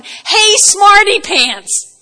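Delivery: God needling Job, slow and loud; sarcastic God. Retold or imitated. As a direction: tease him like a know-it-all kid. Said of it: "and he's going".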